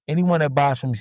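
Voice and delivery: ordinary delivery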